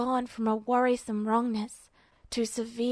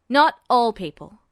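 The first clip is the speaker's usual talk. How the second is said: Duo's voice; 8 dB louder than usual. A narrator's reading. Duo voiced.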